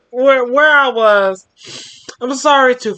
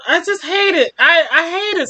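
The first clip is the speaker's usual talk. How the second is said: plain reading voice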